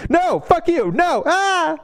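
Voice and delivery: high, squealing hammed-up shout of refusal; mock yelled refusal. Friend imitating a frightened peasant, mock yelled refusal. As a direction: yelp it out like a terrified peasant. Worker refusing to criticize government.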